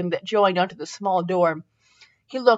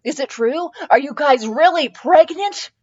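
excited; 7 semitones higher, 4 dB louder than usual. A narrator's reading. Child asks eagerly.

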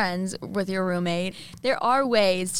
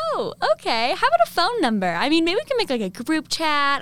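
optimistic; 7 semitones higher than usual. Retold or imitated. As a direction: keep it upbeat and hopeful. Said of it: "I was trying to be all optimistic".

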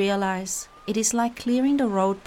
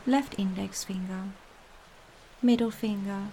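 calm meditative tone. Acted. Guided relaxation meditation.

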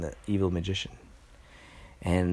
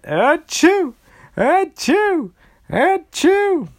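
loud, high sneezes; sneezing; 12 dB louder, much slower than usual. Acted. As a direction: sneeze hard, over and over. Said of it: "And the magician went".

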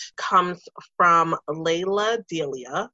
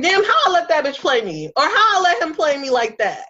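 high, fast exasperated self-scolding; incredulous at herself; 8 semitones higher, faster than usual. Retold or imitated. Looking back on settling, high exasperated rant. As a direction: scold your past self in disbelief. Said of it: "I'm like".

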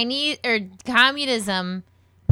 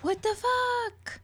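high, slow mock Chinese accent; lightly voiced mock Chinese accent; 4 semitones higher, 4 dB louder, slower than usual. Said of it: "That was my Chinese accent".

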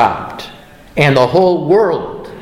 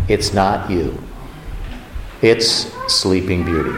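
low, soft oracle-like pronouncement; mirror's verdict; 6 semitones lower, 5 dB quieter than usual. Acted. Magic mirror answering the wicked witch, low and hushed.

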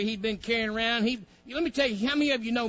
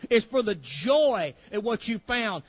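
ordinary delivery

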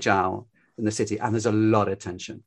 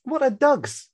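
imitating the singer, high and baffled; puzzled singer; slower than usual. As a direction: mimic her puzzled question, pitched well up. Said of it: "she said".

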